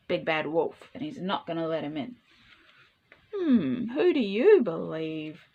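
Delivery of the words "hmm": ordinary delivery, nothing marked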